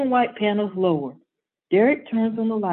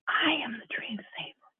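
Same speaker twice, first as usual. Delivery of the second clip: breathy secret whisper, no voice; 4 semitones higher, 10 dB quieter than usual. Acted. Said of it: "a whispering Derek shared".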